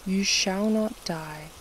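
ordinary talk

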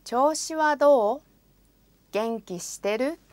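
ordinary talk

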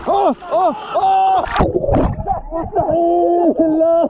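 high, loud cries of real disbelief; can't believe what he just saw; shocked disbelief. Real, not acted. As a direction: gasp it out in total shock at the catch. Friend catches fish by hand.

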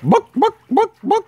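high squawky bawk bawk; chicken clucking. Retold or imitated.

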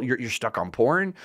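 ordinary talk, nothing marked

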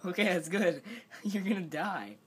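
laughing through the words. Real, not acted. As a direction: giggle while teasing him. Watching friend eat wasabi.

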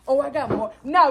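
ordinary talk

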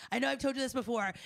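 ordinary talk, nothing marked

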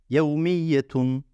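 "Yevmiyetun" drawn out slowly; slow syllables. Shown as a demonstration. Teacher sounding out "Yevmiyetun" slowly for the class. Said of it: "şeklinde okuyoruz".

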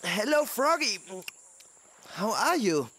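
talking while chewing. Acted. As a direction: mouth full of leaves. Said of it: "fragte Lino kauend".

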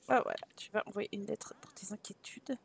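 soft unvoiced whisper; a hushed whisper to the character. Real, not acted. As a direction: whisper it like a secret.

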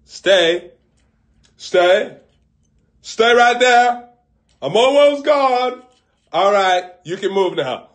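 high, loud, slow calling from afar. Acted. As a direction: call back over your shoulder. Calling back while walking away.

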